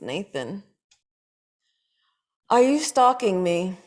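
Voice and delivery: usual speaking voice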